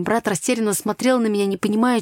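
ordinary delivery, nothing marked

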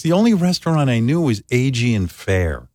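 normal talking voice

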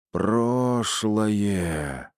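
creaky seance medium voice, drawn out; скрипучий голос. Acted. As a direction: croak it out in a trance. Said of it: "проскрипела женщина". (скрипучим голосом медиума в трансе)

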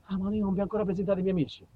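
ordinary talk

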